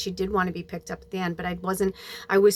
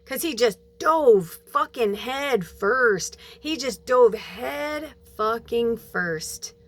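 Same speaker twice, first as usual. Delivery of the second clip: slow emphasis; 3 semitones higher, much slower than usual. Real, not acted. Bitter emphasis on his relapse.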